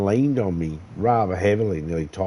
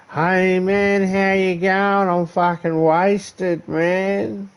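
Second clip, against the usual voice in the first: put-on high, loud and slow drunk voice; mock drunk bloke at a party greeting a sober mate; sloshed drunk; 11 semitones higher, 4 dB louder, much slower than usual. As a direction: slur out a cheery wasted greeting. Imitating a sloshed stranger.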